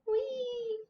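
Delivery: tiny high squeak; squeaky toy voice. Pretend. Cute squeaky toy noises while decorating.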